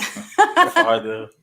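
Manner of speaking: laughing through the word. Real, not acted. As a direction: giggle it out mid-sentence. Laughing while saying father.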